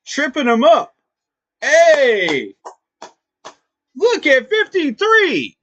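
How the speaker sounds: high, loud delighted fan; excited cheering. Real, not acted. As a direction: cheer the hustle play. Cheering effort play.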